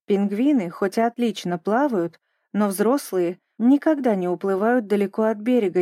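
usual speaking voice

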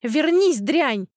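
loud, high furious cry, falling at the end; angry yell. A narrator's reading. Aunt yells after her escaping niece, furious. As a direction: hurl the order after a runaway. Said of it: "Ударил по затылку голос тётки".

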